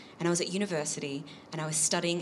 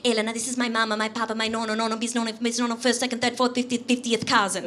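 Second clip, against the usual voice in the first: put-on higher-pitched Italian-accented introductions; Italian host brother; 5 semitones higher, 3 dB louder than usual. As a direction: reel off relatives in an Italian accent. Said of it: "he's introducing to his whole family".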